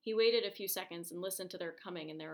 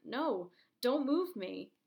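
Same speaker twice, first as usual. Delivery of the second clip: slow, loud, higher cry of protest; desperate plea; 4 semitones higher, 7 dB louder, slower than usual. Acted. Injured man begs the crowd not to move him, loud desperate plea. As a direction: beg them loudly not to touch him.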